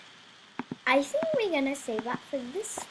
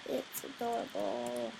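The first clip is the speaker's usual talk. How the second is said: put-on American accent; 3 dB quieter than usual.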